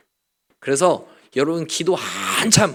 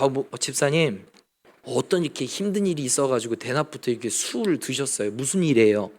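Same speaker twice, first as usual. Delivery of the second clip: softer, caring pastor's voice; gentle concern; 4 semitones lower, 8 dB quieter than usual. Pretend. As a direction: ask kindly as if comforting. 이게 맞지요.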